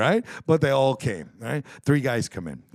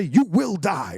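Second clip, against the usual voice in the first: loud, raised mock alarm warning; yelling alarm; 5 semitones higher, 4 dB louder, much slower than usual. Man retells the detector's dire warning, yelling. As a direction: imitate a detector yelling doom. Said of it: "and it's yelling".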